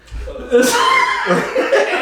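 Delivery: shrieking burst of laughter; high laugh. Real, not acted. Laughing at grocery list line.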